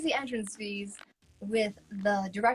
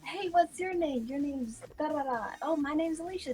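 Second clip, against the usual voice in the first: lightly raised chatty voices; a hint of bubbly girls meeting; chatty pageant girls; 7 semitones higher than usual. Girls making friends in the hotel room, lightly bubbly.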